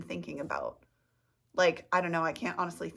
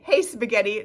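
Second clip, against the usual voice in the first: loud cheery hello to the food; loud greeting; 3 semitones higher, 9 dB louder than usual. Shown as a demonstration. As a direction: call out to it brightly. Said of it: "No, you say, genuinely though".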